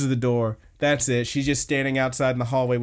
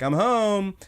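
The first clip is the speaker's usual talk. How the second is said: lightly acted sing-out, pitched up; cheery homecoming call; 7 semitones higher than usual. Co-host retelling a character walking in the door, mock homecoming call. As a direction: call it out like someone arriving home. Voicing character calling out on arrival.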